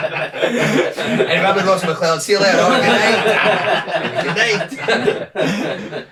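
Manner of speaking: high, loud laughing sign-off. Real, not acted. Comedian laughing as he signs off the bit. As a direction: laugh through the goodnight.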